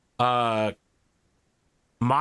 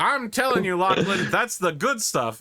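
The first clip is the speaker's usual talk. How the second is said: smug executive; 7 semitones higher than usual. Acted. Executive bragging.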